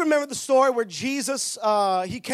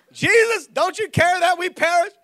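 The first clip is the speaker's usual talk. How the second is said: panicked plea; 6 semitones higher, 5 dB louder than usual. Retold or imitated. Disciples' storm plea retold again.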